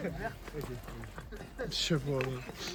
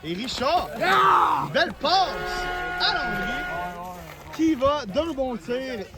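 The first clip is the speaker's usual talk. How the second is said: excited; 7 semitones higher than usual. Real, not acted. Scoring play called live.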